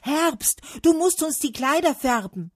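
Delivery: high, loud insistent call; clamouring demand. A narrator's reading. Trees storming Autumn to dye clothes, clamouring. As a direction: demand it loudly, high-pitched.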